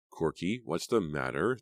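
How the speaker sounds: plain reading voice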